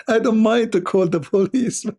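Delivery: high, laughing scorn; laughing mockery. Real, not acted. Mocking the homeowner while laughing. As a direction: laugh through the words at the idea. Mocks calling police too late.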